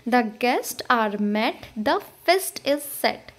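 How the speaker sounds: "The guests are met, the feast is set" very slow, word by word, stressing guests, met, feast, set; regular iambic beat. Shown as a demonstration. Teacher demonstrating meter, "The guests are met, the feast is set" in slow even beats. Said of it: "ये भी regular interval पे आ रहा है".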